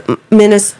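ordinary talk, nothing marked

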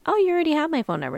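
fast, high mock voice; mock pushy person. Mocking the girl she refuses to be, high and fast. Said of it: "the person that's like".

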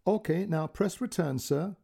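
careful polite dictation of numbers; a hint of an Indian call centre voice; call centre girl. Call centre girl's quote.

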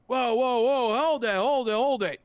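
loud, high Bronx "hold it"; Bronx accent. Acted. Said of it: "That was my Bronx accent".

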